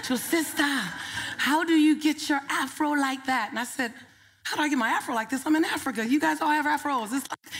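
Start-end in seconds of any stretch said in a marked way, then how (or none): 0.2-3.5 a touch of an African woman asking, impressed; African accent. Retold or imitated. Woman in the audience admiring her afro, lightly voiced African accent. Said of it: "That's my African accent". 4.4-7.1 amused retort. Retold or imitated. Retorting to the woman.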